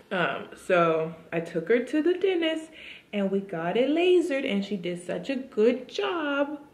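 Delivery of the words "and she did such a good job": high, slow praising coo; baby talk. Acted.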